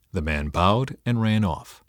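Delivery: plain reading voice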